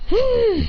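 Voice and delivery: high, strained hum; imitated power-up noise; effortful hum. Character powering up.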